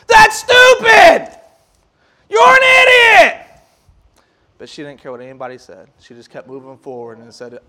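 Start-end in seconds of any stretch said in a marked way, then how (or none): 0.1-3.5 high, loud mocking shout, drawn out slowly; screaming in her face. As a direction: yell the insults right in her face. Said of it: "kind of screaming in her face".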